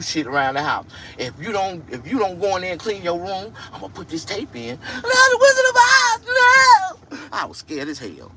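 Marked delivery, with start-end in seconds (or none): 1.2-4.8 hint of grandmother threat. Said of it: "My grandmother used to use". 4.8-7.3 very high, loud wailing plea; terrified child cry. Retold or imitated. Begging grandmother not to play the tape, terrified wailing.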